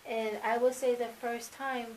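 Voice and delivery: normal talking voice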